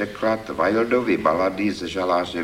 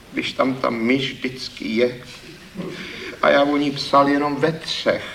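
dejected; 7 semitones higher than usual. Acted. Poet answering the critic, dejected with a rueful laugh. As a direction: sheepishly defend yourself, disheartened. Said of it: "Pravil hlas malomyslně".

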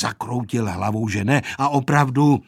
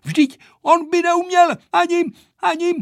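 high, loud, faltering protest; agitated stammer; 5 dB louder than usual. A narrator's reading. Vojta struggling to say what Pepíček cannot do, stammering. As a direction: stumble over the words, voice pitched high. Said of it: "koktal Vojta".

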